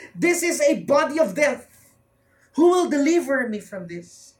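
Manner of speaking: anguished plea; desperate cry. Acted. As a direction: plead for someone to save him. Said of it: "the cry of desperation of Paul".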